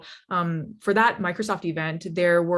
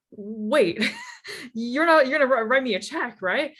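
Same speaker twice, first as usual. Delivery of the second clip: stunned disbelief; 6 semitones higher than usual. Retold or imitated. Stunned as he offers a check.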